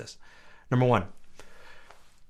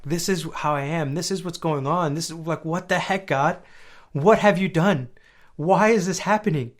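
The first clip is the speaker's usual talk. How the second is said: raised, louder mock protest to God; frustrated plea; 6 semitones higher, 5 dB louder than usual. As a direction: build into a high, exasperated demand for answers. Voicing David's frustrated complaint to God.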